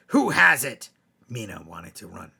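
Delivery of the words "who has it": sudden loud, higher ghostly demand; the ghost springs its question, much louder; ghost voice rising. Acted. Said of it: "it asked".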